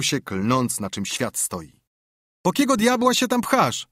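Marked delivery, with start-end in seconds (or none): 2.4-3.9 high, loud, scornful jeer; dismissive. Acted. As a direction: scoff at a partner's pointless effort. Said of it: "powiedział lekceważąco jego kompan". (lekceważąco, wysokim, podniesionym głosem)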